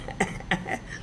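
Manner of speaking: quiet airy chuckle; soft breathy laugh. Real, not acted. Reciter laughs softly at grandpa's burping joke. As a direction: let out a little breathless laugh.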